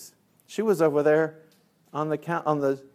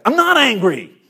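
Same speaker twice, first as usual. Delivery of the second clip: loud, high mock outburst; angry denial; 9 semitones higher, 10 dB louder than usual. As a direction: shout the denial, comically furious. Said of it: "I was like".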